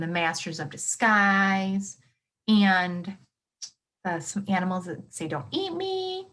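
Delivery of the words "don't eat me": high, slow little plea from a prey animal; mock animal plea. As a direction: squeak it out as the animal begging not to be eaten. Animals warning predators away.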